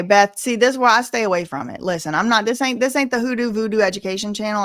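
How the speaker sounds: ordinary delivery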